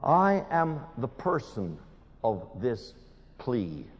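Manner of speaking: ordinary talk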